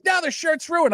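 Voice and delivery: high-pitched aggrieved protest; retold argument. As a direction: imitate an upset cousin complaining. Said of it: "him and I are nose to nose spitting".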